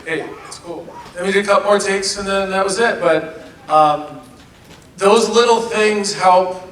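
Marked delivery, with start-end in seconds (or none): 0.0-0.9 soft, quiet hey it's cool; calm a nervous actor down; reassuring. Retold or imitated. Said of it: "him just going, being like".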